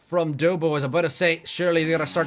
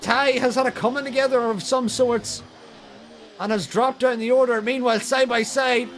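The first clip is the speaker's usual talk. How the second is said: motorsport commentator; 6 semitones higher than usual. Real, not acted. Side-by-side battle call.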